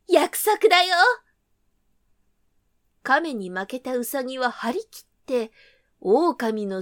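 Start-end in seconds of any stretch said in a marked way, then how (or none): 0.1-0.9 eager. Acted. Rabbit seals the deal.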